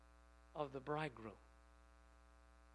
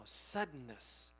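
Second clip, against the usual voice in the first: suddenness said slowly and lower; stressed suddenness; 5 semitones lower, 4 dB quieter, slower than usual. Shown as a demonstration. Preacher emphasizing the word suddenness, slow stress. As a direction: draw out suddenness, slow and weighty. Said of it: "emphasizing the word right now".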